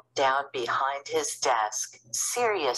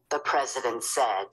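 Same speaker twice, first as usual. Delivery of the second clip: plain reading voice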